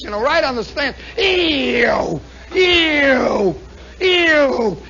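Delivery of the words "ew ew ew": loud, high falling engine wails; planes roaring past. Retold or imitated. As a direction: imitate planes zooming by one after another. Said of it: "they're flying right past us".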